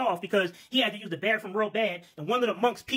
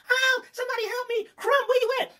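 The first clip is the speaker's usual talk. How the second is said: shrill falsetto scream for help, much higher; high screaming boss. Acted. Boss lady screaming for her worker, high panicked falsetto. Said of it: "She screamed and hollered".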